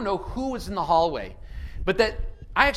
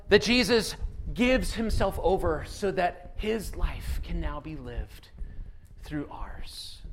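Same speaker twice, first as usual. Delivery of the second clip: slow, softer to a whisper; fading to a whisper; 3 semitones lower, 4 dB quieter, slower than usual. Acted. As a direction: close the thought intimately. Sermon falls to hushed end.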